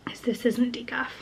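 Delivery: soft whispered aside; whispering. Real, not acted. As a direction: whisper it like a secret. Conspiratorial aside over tea.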